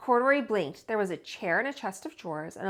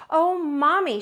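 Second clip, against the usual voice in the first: put-on high, loud excited child; little girl voice; 7 semitones higher, 7 dB louder than usual. Little girl calling to her mother, excited. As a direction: squeal it like a girl who spots her toy. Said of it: "she said".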